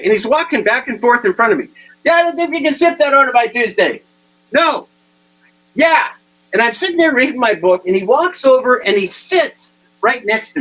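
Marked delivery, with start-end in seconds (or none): 2.0-6.1 loud phone talk. Retold or imitated. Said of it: "He's talking real loud".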